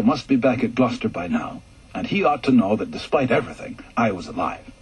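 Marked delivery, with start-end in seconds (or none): none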